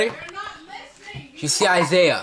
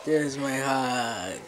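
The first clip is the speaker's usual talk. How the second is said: tender; 3 semitones lower, 4 dB quieter, much slower than usual. Real, not acted. Tender hug.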